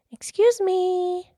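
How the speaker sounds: high, slow, drawn-out politeness; high-pitched, careful little-girl request; very polite girl. Acted. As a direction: stretch it out sweetly in a high child's voice. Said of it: "said as politely as she could".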